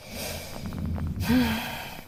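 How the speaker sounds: long breathy sigh; soft dreamy sigh. Acted. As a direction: sigh as if daydreaming.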